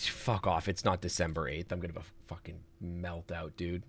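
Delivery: very low, very soft grumble; seething under breath. Real, not acted. Streamer realizing the puzzle answer, seething under breath. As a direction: swear quietly, on the verge of losing it. Defeated muttering as the answer dawns.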